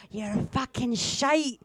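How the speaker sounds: slow Glaswegian heckle; Scottish accent. Retold or imitated. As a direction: drag out a Glasgow heckle. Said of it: "getting so heckled".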